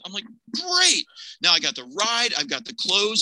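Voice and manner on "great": high-pitched burst of delight; elated reply. Retold or imitated. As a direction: exclaim it high, overjoyed at the offer. Elated at being offered a ride.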